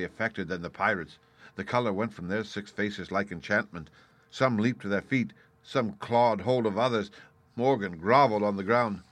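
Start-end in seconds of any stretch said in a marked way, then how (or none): none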